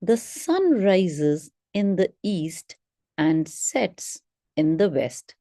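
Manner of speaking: slow, careful read with clear chunks; model read aloud. Shown as a demonstration. Teacher showing students the correct way to read, slow and clear. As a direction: read it slowly, pausing between word groups. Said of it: "let's see what is the correct way to read it".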